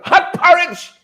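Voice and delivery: indignant cry of hot porridge; outraged exclaim. Real, not acted. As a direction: pitch leaps up in disbelief. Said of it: "indignant repeat".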